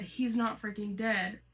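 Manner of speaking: usual speaking voice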